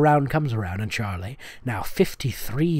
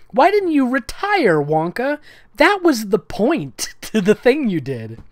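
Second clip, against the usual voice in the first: incredulous; 7 semitones higher, 4 dB louder than usual. Real, not acted. Mock outrage at the story.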